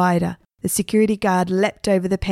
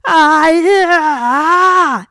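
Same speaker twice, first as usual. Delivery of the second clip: long, high, loud scream; drawn-out "aah" screamed high; 10 semitones higher, 8 dB louder, much slower than usual. A narrator's reading. Guard screaming as the spirit enters his eyes. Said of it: "screamed the security guard".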